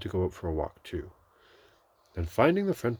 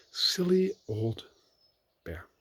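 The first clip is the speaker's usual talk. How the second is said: slow, higher and tender; lovingly; 8 semitones higher, slower than usual. A narrator's reading. Christopher Robin watching Pooh go, loving. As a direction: murmur it fondly. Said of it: "looked after him lovingly".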